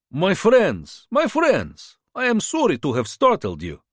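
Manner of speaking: charming border fixer. A narrator's reading. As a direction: sell yourself like a friendly tout. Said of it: "His Russian had an accent".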